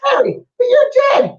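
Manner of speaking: high, loud Scottish cry of disbelief; high Scottish surprise. Retold or imitated. Scottish woman seeing her dead sister, high astonished Scottish voice. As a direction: exclaim in a Scottish lady's voice. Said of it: "it was a Scottish woman".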